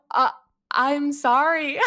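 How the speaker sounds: high, slow, loud mock apology; drawn-out retold apology after a snub. Acted stunned apology to offended Canadian.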